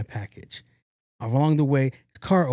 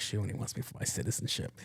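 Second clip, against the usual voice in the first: whispered deadpan; 4 semitones lower, 13 dB quieter than usual. Retold or imitated. Repeating the joke to coworkers, now whispered and dead serious. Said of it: "I'm being dead serious".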